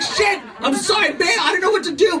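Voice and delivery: a husband caught, shrieking sorry in panic; mock panic. Husband answering screaming wife, hammed-up high panicked yelps.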